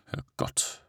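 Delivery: a breathless whispered Herrgott under the breath; whispered curse. Acted. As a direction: let it slip out as a voiceless whisper. Coachman curses in the storm.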